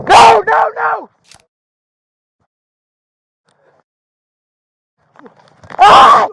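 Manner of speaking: high, loud terrified yell; panicked scream. Real, not acted. Suspect grabs officer's gun.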